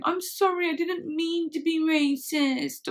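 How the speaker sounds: high, drawn-out mock wailing; mock tearful apology. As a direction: draw it out as a hammed-up, pleading apology. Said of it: "there's enough of that going".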